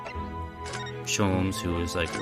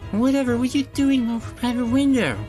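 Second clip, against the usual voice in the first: much higher, louder maid cry; startled maid voice; 13 semitones higher, 6 dB louder than usual. Acted. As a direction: gasp it out as a flustered maid.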